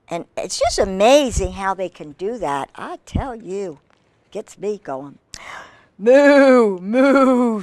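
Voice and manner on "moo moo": long, loud, drawn-out moos; cow mooing. A narrator's reading. Reader voices the cow for children, loud mooing. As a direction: moo like a cow for little listeners. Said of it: "said the cow".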